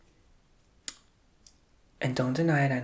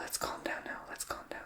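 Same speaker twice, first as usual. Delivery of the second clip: much softer whisper to cool herself down; calming whisper; 11 dB quieter than usual. Real, not acted. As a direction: hush yourself, settle the heat. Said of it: "I can get a little heated sometimes".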